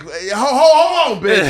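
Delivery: hammed-up angry stop; mock indignant. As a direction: Olivia snapping back at Bosco, high and loud. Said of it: "Olivia was like".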